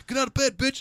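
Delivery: hammed-up tough motivator; David Goggins. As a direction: order him out of bed like a brutal coach. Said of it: "I have David Goggins go".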